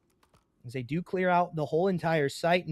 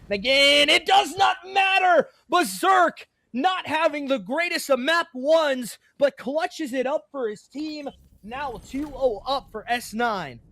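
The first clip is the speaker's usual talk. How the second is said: high, loud esports hype call; excited shout; 9 semitones higher, 5 dB louder than usual. Real, not acted. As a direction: scream it as the clutch lands. Clutch kill, caster erupts.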